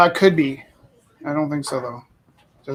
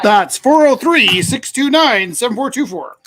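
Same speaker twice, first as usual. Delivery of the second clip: slow and loud; 5 semitones higher, 10 dB louder, slower than usual. Real, not acted. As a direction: read the number slowly and loudly. Repeating number for viewers.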